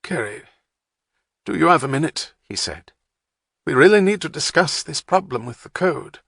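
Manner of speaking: lightly voiced plummy English gent; plummy English accent. A narrator's reading. Saint John asking Wapner for time, plummy English accent. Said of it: "his plummy accent filling the air".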